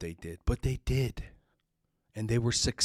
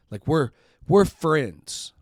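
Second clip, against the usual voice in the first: friends said slow and pressed; stressing friends to make the point; 4 semitones higher, 4 dB louder, slower than usual. Real, not acted. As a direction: lean hard into friends, slowly. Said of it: "I emphasize that word".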